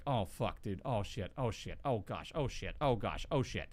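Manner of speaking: low, soft panicked oh shits; hushed freaked-out swearing. Retold or imitated. Retold hushed panic when a dead body seems possible, low and soft. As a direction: mutter the curses under your breath. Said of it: "And I was like".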